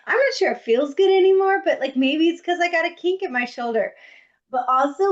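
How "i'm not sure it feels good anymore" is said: a high quick uneasy inner voice; high, fast worried thought. Retold or imitated. Guest at a party, unsure mid-massage, thinks in a high rush. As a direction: pitch jumps up, words rushing in doubt. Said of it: "a point where I'm like".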